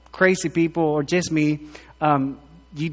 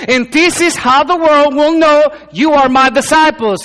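fervent pulpit delivery climbing higher; preaching at full force; 6 semitones higher, 6 dB louder than usual. Acted. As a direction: lift the room, preaching hard. Preaching on loving one another.

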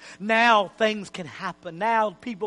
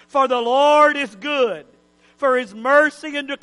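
reverent praise; 4 dB louder than usual. Acted. Preacher showing true praise, building from hush to loud. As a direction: proclaim it from hush to strong. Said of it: "Here's what they were saying".

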